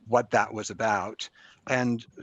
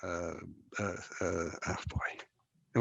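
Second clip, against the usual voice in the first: normal talking voice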